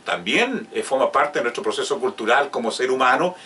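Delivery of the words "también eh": ordinary delivery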